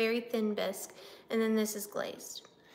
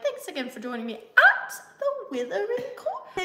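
higher-pitched mock British sign-off; playfully exaggerated British accent, pitched up; 7 semitones higher, 3 dB louder than usual. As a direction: put on a British host's farewell. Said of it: "someone was like".

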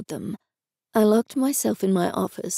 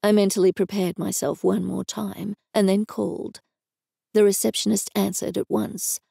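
plain reading voice

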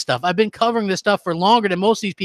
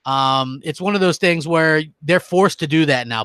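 normal talking voice

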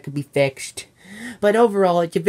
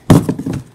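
loud sigh; 9 dB louder than usual. Acted. Frustrated with the box.

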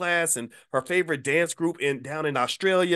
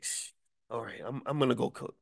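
much softer, sighing surrender; quiet defeated giving in; 8 dB quieter than usual. Retold or imitated. Giving in to his wife, soft and defeated. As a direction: mumble it like a man who has lost. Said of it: "So I'm like".

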